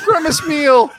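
high, loud cry of Grimace meal; excited shout. Retold or imitated. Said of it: "everyone's like".

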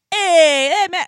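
hollered New Jersey hello; New Jersey accent. Retold or imitated. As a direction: imitate a boisterous New Jersey guy. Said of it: "just their Ju-, New Jersey accents".

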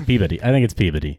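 Peabody with a light, reduced "body". Shown as a demonstration. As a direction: say Peabody without leaning on "body". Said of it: "saying body so hard".

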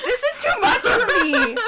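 high laughing squeal; excited shriek. Real, not acted. Excited shrieking at correct guess.

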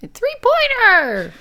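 high, stretched-out mock yell; drawn-out, hammed-up high cry; playful yell. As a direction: holler it long and high in play. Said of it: "If she yells it".

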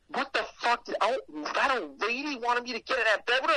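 high-pitched, hurried disbelief; appalled disbelief. Retold or imitated. Storyteller reliving the creepy bedroom invitation, appalled disbelief. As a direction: blurt it out high, still appalled. Reliving his shock afterwards.

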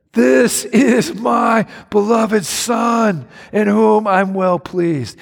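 high, slow proud call from heaven; proud shout. Acted. God the Father calling over his beloved son, proud high shout. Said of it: "he yelled out from the grandstand".

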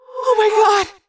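high, loud, horrified moan; Cassie. A narrator's reading. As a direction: cry out at the horrible sight. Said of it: "Cassie moaned as she saw Rachel".

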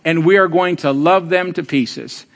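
normal talking voice

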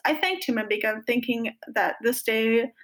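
usual speaking voice

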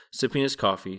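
plain reading voice

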